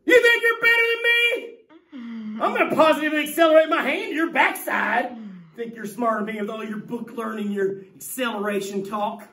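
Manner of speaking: mock angry parent, high and loud at first. Teacher mocking a parent yelling at a smart kid, hammed-up shout. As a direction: ham up a parent yelling at a know-it-all kid. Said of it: "their parents might be like".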